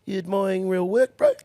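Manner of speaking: put-on gruff old Kiwi teasing, lightly done; old Kiwi stable hand needling the kid, light Kiwi accent. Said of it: "old Kiwi fella".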